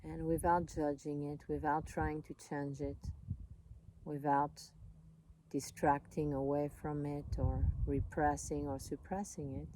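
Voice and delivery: calm, unhurried voice with long pauses; soothing meditation guide, leaving space between phrases; guided meditation. Real, not acted.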